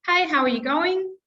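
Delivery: friendly hello to a colleague; message banked greeting. Shown as a demonstration. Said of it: "I'm going to use the example of".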